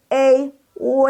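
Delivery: E, W as ei, then wei, held high; built-up wei. Shown as a demonstration. Teacher shows where it comes from, each sound high and level. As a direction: sustain ei, then blend it into W as wei. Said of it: "Dia tuh berasal dari".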